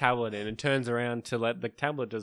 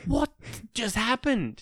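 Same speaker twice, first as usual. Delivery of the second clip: high, stunned what just happened; stunned disbelief; 9 semitones higher, slower than usual. Retold or imitated. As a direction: ask it in disbelief. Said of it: "We're like".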